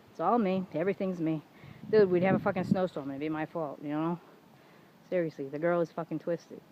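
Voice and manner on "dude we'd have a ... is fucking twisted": ordinary delivery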